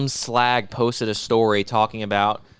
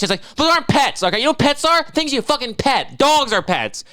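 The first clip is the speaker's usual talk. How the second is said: higher-pitched escalating rant; 10 semitones higher than usual. Real, not acted. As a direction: rail at them in disgust. Ranting about pet owners.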